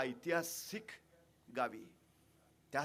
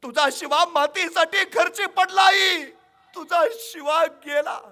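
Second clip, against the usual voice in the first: loud, slow, much higher cry of loss; grieving shout; 13 semitones higher, 16 dB louder, slower than usual. Acted. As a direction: shout the death news slowly. King announces the son's death. (मोठ्याने आक्रोश करत सांगितलेली बातमी)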